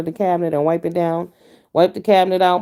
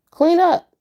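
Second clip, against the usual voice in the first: sudden high yell of clean up; yelled order; 11 semitones higher, 5 dB louder than usual. Retold or imitated. Parent yelling at kids to clean up, high yell. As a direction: bark the order up high and loud. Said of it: "you're like".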